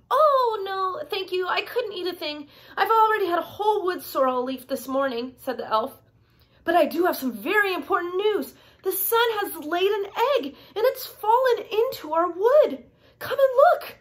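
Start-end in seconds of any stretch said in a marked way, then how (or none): 0.1-5.3 high-pitched little elf, politely declining; storybook elf voice. A narrator's reading. 6.6-14.0 high little elf with important news; eager storybook elf announcing a discovery; excited elf. A narrator's reading. Voices the elf's big news.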